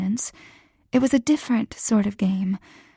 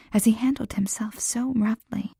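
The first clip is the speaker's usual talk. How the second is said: plain reading voice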